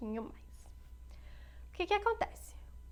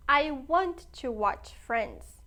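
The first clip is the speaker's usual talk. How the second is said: slow, deliberate teacher's demo; slow classroom model reading; slow model sentence; 3 dB louder, much slower than usual. Shown as a demonstration. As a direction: spell each word out slowly for the class. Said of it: "teacher models English sentence".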